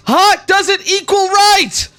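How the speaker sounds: high, slow chanted yell; protester yell. Acted. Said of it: "Another person's yelling and saying".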